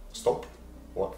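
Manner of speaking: ordinary delivery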